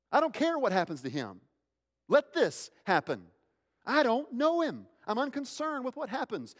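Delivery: high, emphatic outburst; cursing disavowal. Acted. Peter cursing Christ to save himself, vehement disavowal. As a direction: disown him forcefully, pitch climbing very high. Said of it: "would curse Christ".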